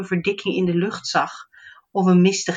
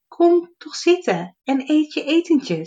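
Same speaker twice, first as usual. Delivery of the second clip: gentle fairy; 9 semitones higher than usual. Acted. Fairy inviting the gnome to sit and eat, high gentle voice. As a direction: coax him kindly to sit. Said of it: "zei ze".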